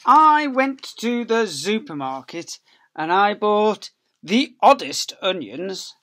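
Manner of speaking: high, loud, slow storybook voice; reading to children. Acted. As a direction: read it out big and lively for little ones. Reading the picture book aloud.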